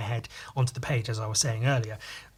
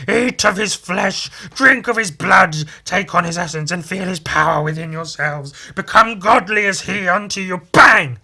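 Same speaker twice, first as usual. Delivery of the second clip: cult leader proclamation; 6 semitones higher, 10 dB louder than usual. Acted. Cult leader urging his followers, booming theatrical fervour. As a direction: thunder it out until cut off by a bang. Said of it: "And then he goes".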